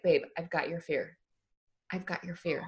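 soft, cracking, near tears. Retold or imitated. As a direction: let the voice crack softly. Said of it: "you'll hear my voice crack up".

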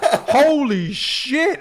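high, loud, drawn-out "Holy shit" with a laugh; stunned, laughing swear at a huge surprise. Retold or imitated. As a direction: let it out slow in laughing disbelief. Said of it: "I'm just swearing".

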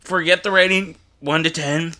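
chewing something disgusting; grossed out mid-bite; eating gross jelly beans. Real, not acted. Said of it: "nine thousand bad".

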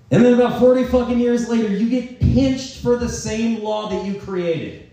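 high-pitched, worked-up disbelief; high comic outrage; incredulous. Real, not acted. Incredulous punchline of racketeering story.

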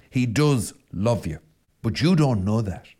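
ordinary talk

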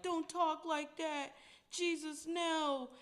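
pleading disciple. Retold or imitated. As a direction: beg him gently not to say it. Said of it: "And Paul was like".

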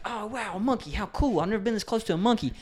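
hammed-up wonder over a party monkey; mock amazement. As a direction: play the wide-eyed diner thrilled by a monkey. Voicing a diner amazed by a monkey.

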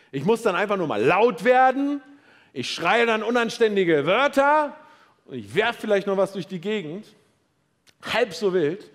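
shouting about shouting, half in jest; mock rant. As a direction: play the hothead who just blows off steam. Imitating someone venting rage.

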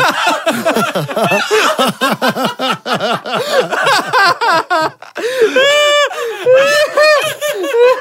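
high, wheezing fit of laughter; shrieking with laughter; helpless high laughter. Real, not acted. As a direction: crack up completely. Helpless laughter at own story.

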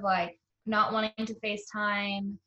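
ordinary delivery

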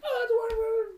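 high chirpy clerk voice; shop lady voice. Retold or imitated. Son imitating the pet store lady, high chirpy clerk voice. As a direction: sing it out like a cheery clerk. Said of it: "the lady's like".

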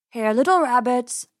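luring farmer's call; coaxing farmer. A narrator's reading. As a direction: call the hidden rabbits out. Farmer coaxes the hidden rabbits.

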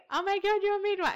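high, thrilled mock squeal; imitating a woman in the toilets gushing; gushing stranger. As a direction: squeal like a tipsy fan.

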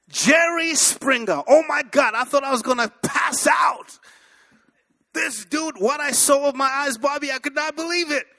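loud, high-pitched disbelief; astonished. Real, not acted. Preacher telling the church of his first Jerry Springer show, astonished. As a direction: cry out as if about to pass out.

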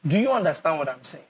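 normal talking voice

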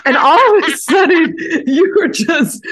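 high, loud outraged squeal; squeal volume. Real, not acted. Defending Ferrari to co-host in a squealing outraged voice. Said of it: "You've activated squeal volume".

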